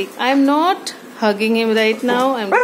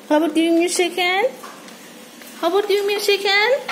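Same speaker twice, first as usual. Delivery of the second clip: higher, fast sing-song ask; coaxing pet voice; 7 semitones higher, faster than usual. Real, not acted. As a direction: coax the pet up in pitch. Coaxing pet to shake hand.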